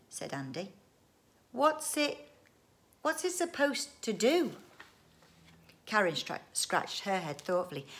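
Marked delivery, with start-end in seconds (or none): 1.5-4.6 high-pitched querying; puzzled child's question. Acted. Child asks dad about machine, high puzzled question.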